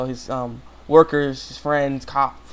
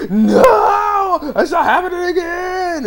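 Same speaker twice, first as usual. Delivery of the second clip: anguished shout; 6 dB louder, much slower than usual. Retold or imitated. Hero sees allies dying.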